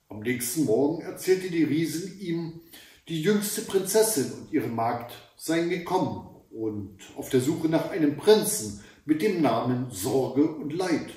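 ordinary delivery, nothing marked

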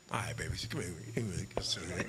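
calm him down gently, low and hushed; soothing wife. Retold or imitated. Wife softly calls her angry husband over.

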